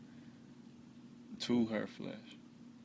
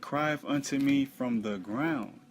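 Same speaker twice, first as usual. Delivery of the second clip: loud, higher, slow declamation; scripture proclamation; 3 semitones higher, 9 dB louder, slower than usual. Acted. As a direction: proclaim the verse forcefully and slowly. Reads scripture aloud.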